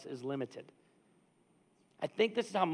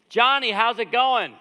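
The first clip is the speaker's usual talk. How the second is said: loud, high, drawn-out greeting; role-played pumpkin patch owner; 7 semitones higher, 10 dB louder, slower than usual. Owner showing up and greeting Johnny, booming role-play. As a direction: call out heartily as the boss arriving. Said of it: "I'm just gonna role play this".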